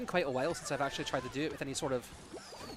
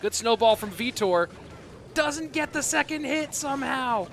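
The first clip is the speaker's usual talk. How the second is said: excited shouted casting, much higher and louder; live play-by-play; 11 semitones higher, 10 dB louder, slower than usual. Acted. Caster calling a close match for viewers, loud and excited. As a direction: call the match like a thrilled caster.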